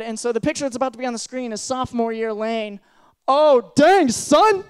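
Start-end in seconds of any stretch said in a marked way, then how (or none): 3.3-4.6 excited exclamation. Real, not acted. Reacting to old photo of himself.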